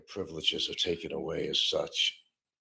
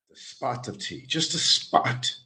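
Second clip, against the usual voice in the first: posh-sounding British spot, pitched up; mock British accent; 6 semitones higher than usual. Mocking how British people talk, in a mock British accent. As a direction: play a prim English tea drinker. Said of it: "I love the way the British talk".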